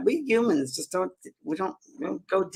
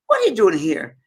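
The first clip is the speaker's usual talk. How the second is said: louder, higher mock-Scottish question; Mary, Scottish lady; 3 semitones higher, 7 dB louder than usual. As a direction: ask it in a put-on Scottish accent. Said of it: "I'm trying to my Scottish accent".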